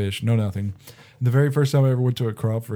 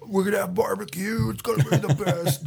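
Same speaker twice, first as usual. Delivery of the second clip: mock excited boss; 9 semitones higher than usual.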